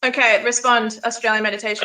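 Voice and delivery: loud call to the next speaker. Real, not acted. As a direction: call them in firmly, voice up. Moderator calling on next speaker.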